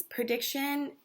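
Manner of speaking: prediction said slowly and clearly; stressed word. Shown as a demonstration. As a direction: spell out prediction for the kids. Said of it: "a prediction is when you".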